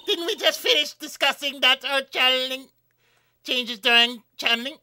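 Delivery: too harsh. Acted. Player reading a line about channeling, overly harsh. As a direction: snap it out far too forcefully. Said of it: "I get it too harsh".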